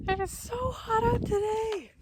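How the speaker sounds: high, slow, strained moan about the heat; whiny complaint. Real, not acted. Hiker complaining to camera about the heat, high and slow. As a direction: drag it out, melting in the sun.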